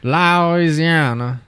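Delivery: drawn out slowly through a thick mouthful; mouth full of peanut butter. Real, not acted. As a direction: stretch it out round sticky peanut butter. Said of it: "like he's got peanut butter in his mouth".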